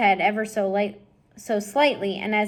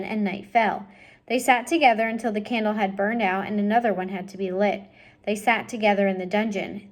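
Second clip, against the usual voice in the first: usual speaking voice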